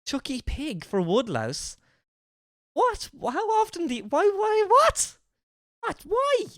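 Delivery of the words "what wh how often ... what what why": very high-pitched incredulous squeak; squeaky, flabbergasted questioning; baffled disbelief. Real, not acted. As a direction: stammer it out in high-pitched bewilderment. Baffled reaction to slang phrase.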